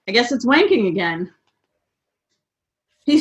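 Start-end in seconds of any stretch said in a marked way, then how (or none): none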